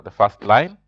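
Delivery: ordinary talk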